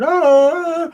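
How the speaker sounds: sharp yelp as she's hit; a hammed-up yelp, high and short; high mock cry of pain. Wife knocked down by the sister, yelping. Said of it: "my wifey said".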